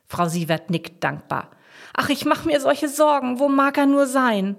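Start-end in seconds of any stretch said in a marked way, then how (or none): 1.9-4.6 high, plaintive worry, growing louder; anxious lament for someone missing; worried. A narrator's reading. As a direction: fret aloud with pitch held high. Owner worries over missing dog.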